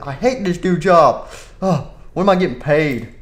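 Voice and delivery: usual speaking voice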